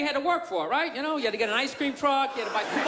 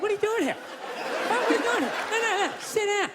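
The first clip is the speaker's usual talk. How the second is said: higher, hammed-up TV confrontation voice; TV sting host; 6 semitones higher than usual. Show host confronting a man at the door, hammed-up. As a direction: play the gotcha host catching someone. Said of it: "predator guy to come walking out like".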